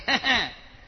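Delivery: loud short laugh; a loud amused laugh; burst of laughter. Real, not acted. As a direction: laugh at the jab. Laugh after mock insult.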